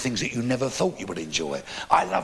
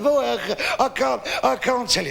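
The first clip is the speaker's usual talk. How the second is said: high-pitched mock sobbing; crying old man; 10 semitones higher than usual. Old man too upset to tell a stranger, tearful. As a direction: stammer it out as a weeping old man. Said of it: "crying his eyes out".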